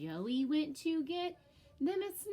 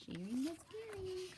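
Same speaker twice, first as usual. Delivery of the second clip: class saying; 10 semitones higher, 4 dB quieter than usual. Shown as a demonstration. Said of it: "We say that in our class".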